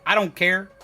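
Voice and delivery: ordinary delivery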